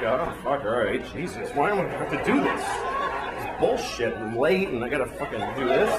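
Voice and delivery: low muttered complaining; grumbling reluctant. Pretend. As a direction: grumble under breath, annoyed. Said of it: "I'm like".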